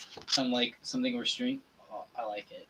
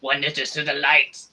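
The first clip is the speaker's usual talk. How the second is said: loud, ominous villain proclamation; scary voice; 5 dB louder than usual. Acted. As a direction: proclaim it like a dark lord. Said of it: "is it bad for me to say that I like the scary voice I did".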